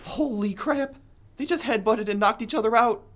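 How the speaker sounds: high, stunned exclamation; shocked. Acted. Producer shocked as comedians knock each other out, high. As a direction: gasp it out in disbelief.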